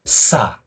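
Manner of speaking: "sa" with a held hissing s; slightly hissed consonant. Shown as a demonstration. Teacher demonstrating sangsiot, "sa" with a slight hiss. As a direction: hiss briefly, then open into "sa". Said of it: "Kayak berdesis sedikit".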